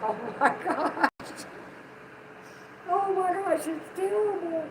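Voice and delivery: high, shocked squeal of dismay; playful high-pitched disbelief; mock horror at own voice. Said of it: "I was like".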